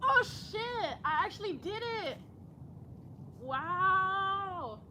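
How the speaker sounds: high-pitched, stunned delight; amazed. Real, not acted. Yoga host upside down in her first backbend, amazed. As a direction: blurt it out, astonished at your own success.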